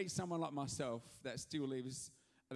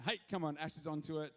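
ordinary delivery

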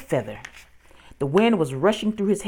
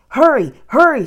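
high, loud, frantic repeated cry; screamed urging; 9 semitones higher, 10 dB louder than usual. A narrator's reading. Girl rushes her brother up the ladder, screaming urgently. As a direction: scream to rush someone along. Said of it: "screamed Annie".

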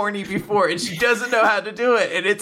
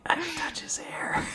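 high, soft mock whisper; whispered secret thought; 9 semitones higher, 10 dB quieter than usual. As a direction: whisper a shy secret longing. Girl's secret thought acted out.

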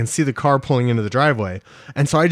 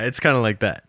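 ordinary talk, nothing marked

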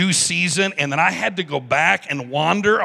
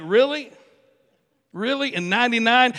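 incredulous; 7 semitones higher than usual. Acted. Abraham reacting to God's promise.

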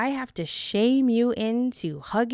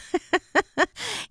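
bright high chuckle; quick amused giggle; short laugh; 11 semitones higher than usual. Real, not acted. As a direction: laugh at your own line. Amused laugh after her joke.

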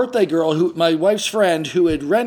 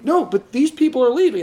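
feverish explaining; 7 semitones higher than usual. Retold or imitated. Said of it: "feverishly explaining".

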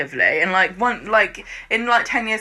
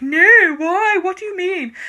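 higher, mock-defensive denial; mock indignant; 10 semitones higher than usual. [name] to Asha, a mock indignant high denial. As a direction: play it caught out and defensive.

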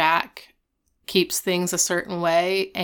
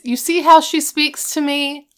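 higher, louder appeal for sympathy; mock indignant complaint; 7 semitones higher, 5 dB louder than usual. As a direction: complain about her to her father. [name] complains to her dad.

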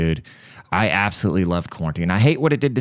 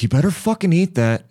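higher mock threat at the dog; 10 semitones higher than usual. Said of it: "He's like".

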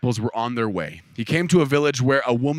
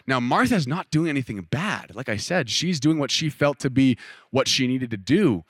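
ordinary delivery

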